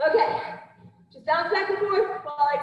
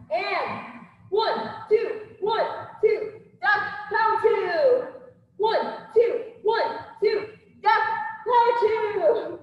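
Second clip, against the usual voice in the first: boxing coach calling combos; 7 semitones higher, slower than usual. Acted. Instructor calling combo reps.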